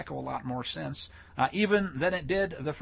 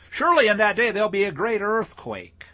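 loud, raised proclamation; declaimed scripture; 5 semitones higher, 7 dB louder than usual. Acted. Preacher reading the earthquake prophecy, loudly declaimed.

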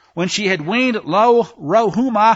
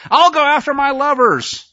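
lightly voiced scornful harlot, higher and louder; mocking the harlot; 6 semitones higher, 4 dB louder than usual. Preacher mocks the faithless nation, a touch of put-on voice. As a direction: sneer it as the defiant unfaithful wife. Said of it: "she said".

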